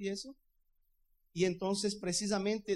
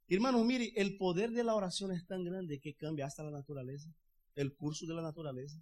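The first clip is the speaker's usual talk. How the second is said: ordinary delivery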